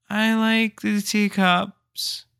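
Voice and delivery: put-on high, very slow little boy; a timid boy naming his safe ride; shy kid voice.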